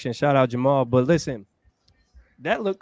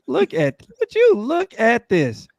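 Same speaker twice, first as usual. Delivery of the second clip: high, drawn-out disbelief; really incredulous, pitched up and slow; 8 semitones higher, 3 dB louder, slower than usual. Real, not acted. Incredulous at the jersey picture.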